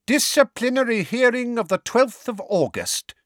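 high, ringing official proclamation. A narrator's reading. Fudge formally opening the disciplinary hearing, ringing voice. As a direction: proclaim it to the whole courtroom, pitched right up. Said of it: "said Fudge in a ringing voice".

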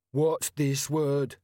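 troll sergeant announcing to the crowd; slow troll. A narrator's reading. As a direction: spell it out slowly like a dim troll.

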